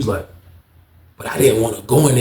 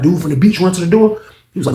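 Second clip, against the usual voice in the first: usual speaking voice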